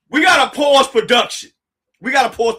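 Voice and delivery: high, loud call to stop; excited shout. Real, not acted. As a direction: shout it in hyped disbelief. Overwhelmed, shouting at viewers.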